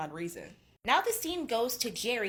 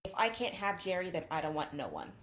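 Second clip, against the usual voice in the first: subdued, withdrawn retelling; mildly depressed; 2 semitones lower, 5 dB quieter than usual. Retold or imitated. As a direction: imitate someone sunk in gloom, softly. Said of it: "back in a deep depression".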